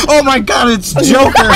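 high, giddy exclamation; excited squeal. Real, not acted. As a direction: burst out in delighted disbelief. Dungeon master reacting to Joker.

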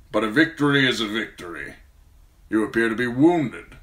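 cold, measured lord; calm, icy authority; Lord Tywin's voice. A narrator's reading.

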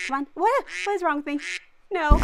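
ordinary delivery

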